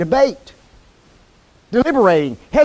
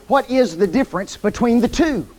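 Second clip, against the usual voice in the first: ordinary delivery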